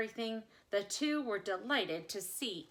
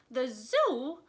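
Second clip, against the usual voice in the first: loud, dismayed rising question; miserable exclamation; 4 semitones higher, 7 dB louder than usual. Acted. Mother hearing Lyle goes to the zoo, loud dismayed cry. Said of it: "exclaimed miserably".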